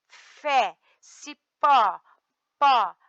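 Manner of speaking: ordinary talk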